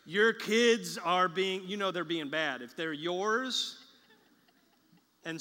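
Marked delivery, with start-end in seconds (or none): 0.1-1.6 loud, slow, pointed wife's complaint; wife pointedly telling her husband the kids are his; exasperated wife. Retold or imitated. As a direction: lean hard on it like a fed-up wife. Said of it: "your wife comes to you and says".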